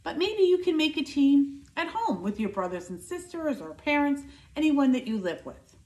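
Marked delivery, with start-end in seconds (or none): none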